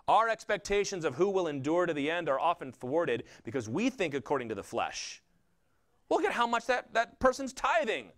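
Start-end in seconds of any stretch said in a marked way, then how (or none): 6.1-8.1 high-pitched mock amazement at someone's piety; naive admiring onlooker. Church member marvelling at another's devotion, high mock admiration. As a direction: gush in an impressed onlooker's high voice. Voicing people who judge by appearances.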